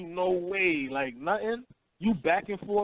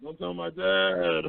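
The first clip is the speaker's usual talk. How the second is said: put-on whiny Italian boy; slower than usual. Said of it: "He was like".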